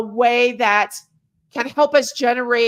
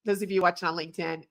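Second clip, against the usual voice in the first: ordinary delivery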